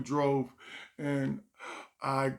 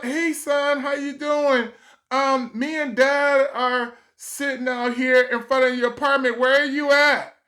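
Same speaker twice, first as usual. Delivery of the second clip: higher, louder mom voice; lightly voiced mom calling her son; 10 semitones higher, 8 dB louder than usual. A mom on the phone asking her son, higher and louder.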